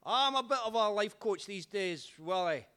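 dad answering the drunk pal, lightly hammed; dad's voice. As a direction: play dad humouring the drunk. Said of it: "He's like".

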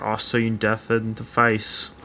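mock dramatic voiceover. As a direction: ham up the grim closing voiceover, hushed and slow.

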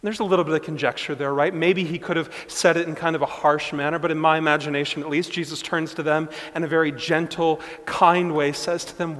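loud, fast preacher's build-up; preaching. Acted. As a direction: drive the point home from the pulpit, quickly. Preacher building to full force.

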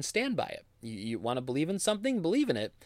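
ordinary delivery

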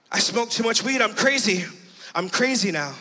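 ordinary talk, nothing marked

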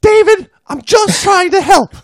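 high falsetto nagging wife; mock wife voice. Wife pleading with her husband after the dive, high falsetto. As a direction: squeak it out as the fussing wife.

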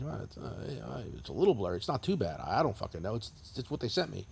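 low, soft noncommittal mumble; hesitant shrug. Retold or imitated. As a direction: think aloud, unimpressed and unsure. Acts himself squinting at blurry photo.

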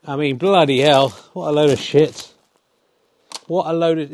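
loud, disgusted rant at a bad frame; mock outraged commentator. Commentator two rants to the viewers, loud and disgusted. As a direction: blow up at how awful the play was.

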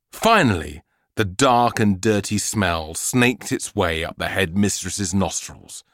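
usual speaking voice

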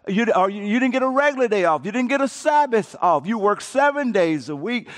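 ordinary talk

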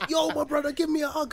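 high, loud, joyful greeting; exuberant friend. Retold or imitated. Imitating his friend hanging out the window, high and loud.

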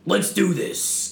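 hammed-up action hero; tough guy. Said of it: "kind of dude".

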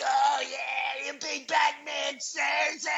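high mocking yell at the pitcher; taunting fan shout. Fan jeering at Max Scherzer on TV, high taunting shout. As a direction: jeer Scherzer like a rowdy fan.

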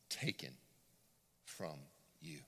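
"Taken" low and slow with pauses; drawn-out Taken. Shown as a demonstration. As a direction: lean deep on "Taken". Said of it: "what's that word?".

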